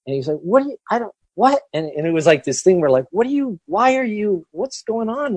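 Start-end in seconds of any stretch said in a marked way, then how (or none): 0.4-1.6 baffled. Retold or imitated. Retold baffled passer-by. 3.1-5.3 baffled. Retold or imitated. Retold baffled passer-by.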